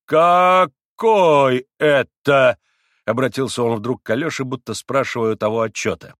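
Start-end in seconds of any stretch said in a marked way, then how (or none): 0.1-2.6 very slow, loud, high, stretched question; pompous astonished demand; affronted amazement. A narrator's reading. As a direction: draw out each word in indignant surprise. Affronted doctor demands explanation.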